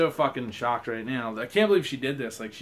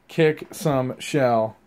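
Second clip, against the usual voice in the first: slogan cheer; 8 dB louder, much slower than usual. Real, not acted. Quoting the towel slogan.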